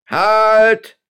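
high, loud, drawn-out halt; piercing command; shouted call. A narrator's reading. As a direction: yell to stop a fleeing man. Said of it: "rief ihm jetzt Toñuelo zu".

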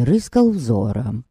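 ordinary delivery, nothing marked

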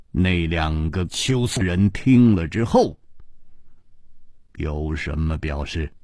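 low, level and cold. A narrator's reading. Ji Bingyan cuts in to question Situ Liuxing, coldly. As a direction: ask it coldly in a low monotone. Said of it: "冷冷地说".

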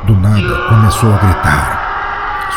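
usual speaking voice

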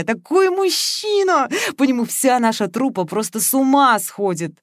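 high, slow, swooning over him; swooning rapture. Acted. С восторгом, томно. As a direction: Veronika gushes about the young actor, swooning.

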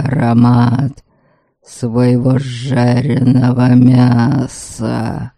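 low, slow rumbling spirit; rumbling ghost. A narrator's reading. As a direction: roar it out like a wrathful ghost. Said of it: "продолжал извращаться в угрозах".

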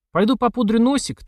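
high, innocent lilt; coy. A narrator's reading. Girl excusing herself to a suitor, coy and high. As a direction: say it lightly, as to an admirer. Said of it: "так обычно говорят девушки кавалерам".

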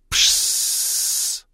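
long unvoiced hiss; hissing extinguisher. A narrator's reading. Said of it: "засвистел огнетушитель".

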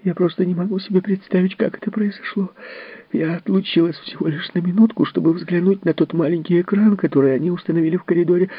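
high, tearful outburst of a guilty nurse; pleading her case, voice pitched high and strained; nurse Mandy crying out. Acted. The nurse defends herself to Miss Fellowes, high and tearful. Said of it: "выкрикнула вдруг Мэнди".

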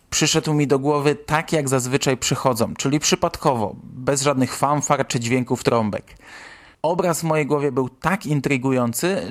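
usual speaking voice

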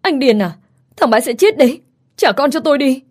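crying and shouting. A narrator's reading. Mother screaming at the man drowning her son, crying and shouting. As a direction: scream at him through tears, pitch rising. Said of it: "vừa khóc vừa hét lên".

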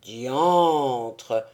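low, loud exclamation; a low startled oath; Blasius voice. A narrator's reading. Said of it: "fit Blasius".

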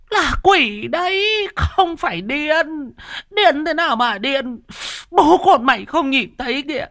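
high, shrill-pitched terrified wife insisting on a ghost; high frightened woman who swears she sees it; panicked woman pointing at the ghost, high voice; frantic old woman. Acted.

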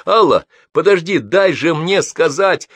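громко, высоко, с отчаянной настойчивостью; удержи уходящую, требуя выслушать; просит подождать. A narrator's reading. Пытается удержать уходящую женщину. (взволнованная настойчивая просьба)

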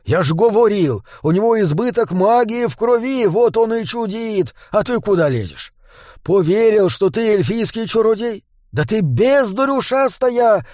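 high-pitched angry scolding; indignant rant, pitched well up; exasperated howl. A narrator's reading. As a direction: berate a hopeless pupil, ending in an order. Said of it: "взвыл Дворф".

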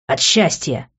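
usual speaking voice

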